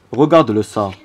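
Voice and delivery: ordinary delivery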